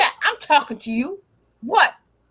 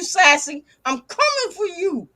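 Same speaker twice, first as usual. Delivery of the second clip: ordinary delivery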